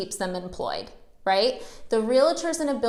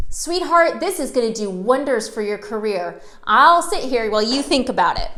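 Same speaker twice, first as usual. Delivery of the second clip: mock sweet-talking persistence; pushy old salesman; 5 dB louder than usual. Old salesman insists on staying.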